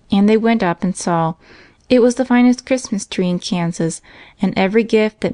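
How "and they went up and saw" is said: usual speaking voice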